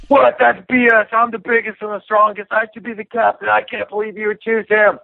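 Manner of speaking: high, mocking drunk yell; imitating a drunk Bonnar yelling. Fighter mocking drunk Stephan's rant in high pitch. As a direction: ham up the outraged drunk demanding captaincy. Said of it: "he starts yelling".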